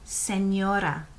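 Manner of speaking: slow Spanish señora with a clear ny; model pronunciation. Shown as a demonstration. Teacher models señora for students, slow and careful. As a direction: say señora slowly for repeating.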